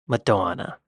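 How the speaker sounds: mock the name in a muddled British-Long Island accent. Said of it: "British and Long Island".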